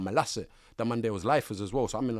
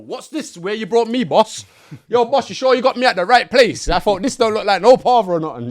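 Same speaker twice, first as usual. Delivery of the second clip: much higher, much louder disbelief; staring up at the walls in disbelief; loud, high-pitched amazed questioning; shocked; 10 semitones higher, 14 dB louder than usual. Retold or imitated. Shocked arriving at big jail.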